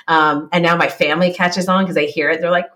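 usual speaking voice

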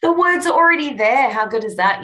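high, loud, fast delight; thrilled the words just appear; excited. Real, not acted. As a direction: gush with glee. Excited about transcription.

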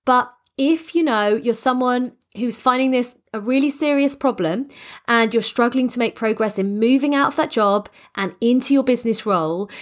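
ordinary talk

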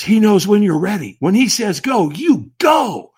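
loud, high, rising to a punch on go; retold urgent message. Stranger urging a man waiting in line, loud and urgent. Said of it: "he whispers in my ear".

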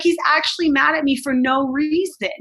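insistent protest. Retold or imitated. Mother retelling telling the roommate he's angry for no reason, insistent. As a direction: push back, insisting you did nothing. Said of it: "I was like".

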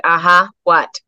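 wary. Retold or imitated. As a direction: brace for bad news. Mom bracing for bad news.

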